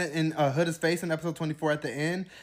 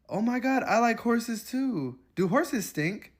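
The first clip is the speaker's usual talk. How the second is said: higher, slow, giddy bimbo voice; mock airhead; 7 semitones higher, slower than usual. Mocking the couple's dull talk, high and giddy. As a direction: gush like a starstruck teen. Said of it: "This is how they sound".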